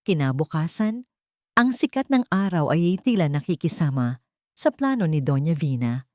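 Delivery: ordinary delivery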